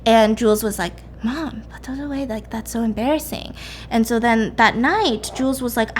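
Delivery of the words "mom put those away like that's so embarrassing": softly voiced embarrassed kid; embarrassed hushed plea. Jules to her mom about cereal, hushed embarrassment.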